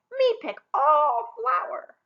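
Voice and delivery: slow smug brag; grinning boast. Acted. Younger sister dreaming of flowers, grinning brag. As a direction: savour the boast with a grin. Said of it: "the younger one grinned".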